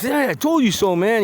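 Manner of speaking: high teasing I-told-you-so. Retold or imitated. Ray ribbing his friend, teasing told you so. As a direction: rub it in, lightly acted.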